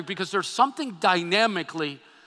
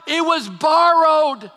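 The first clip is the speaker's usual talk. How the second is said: crying out; 6 semitones higher, 6 dB louder, slower than usual. Acted. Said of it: "he cried out".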